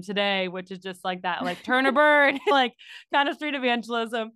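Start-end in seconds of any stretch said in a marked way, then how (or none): 1.6-2.4 punchy mock preacher slogan, louder; mock turn-or-burn preacher. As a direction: bark it like a cartoon street evangelist. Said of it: "the worst street evangelism".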